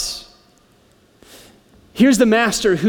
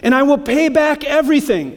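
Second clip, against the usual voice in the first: higher, pleading servant voice; begging servant; 8 semitones higher than usual. A narrator's reading. Servant pleading with his master, higher and earnest. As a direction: beg the master for more time. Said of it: "he begged".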